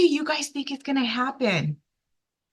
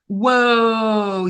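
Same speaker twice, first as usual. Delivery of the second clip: whoa; 6 dB louder than usual. Real, not acted. Host reacts to fish size.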